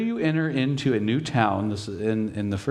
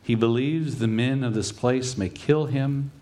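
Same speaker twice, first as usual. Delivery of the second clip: usual speaking voice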